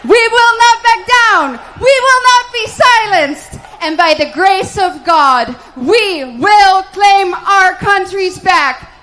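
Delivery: loud, high rallying cry; rally speech. Acted. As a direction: rouse the crowd to a climax.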